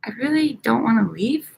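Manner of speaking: ordinary talk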